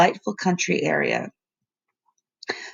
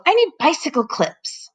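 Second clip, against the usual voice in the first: yelling; 8 semitones higher than usual. A narrator's reading. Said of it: "he yelled".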